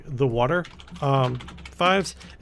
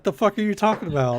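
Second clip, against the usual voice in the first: mock exasperated outburst; 7 semitones higher, faster than usual. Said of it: "I'm like".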